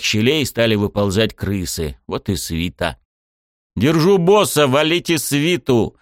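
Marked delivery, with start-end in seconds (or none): 3.8-6.0 loud, urgent order; battle shout. Acted. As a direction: bark the order over the fight.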